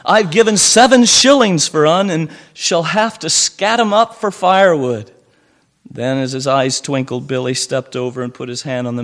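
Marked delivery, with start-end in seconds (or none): 0.0-5.1 higher, loud grumble; angry. A narrator's reading. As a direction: vent about the wasted shillings. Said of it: "said the purchaser angrily".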